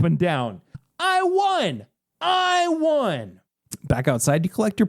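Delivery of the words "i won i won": high-pitched victory yell; acted jubilant winner; triumphant cry. A narrator's reading. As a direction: celebrate way up high. Winner jumping up and down.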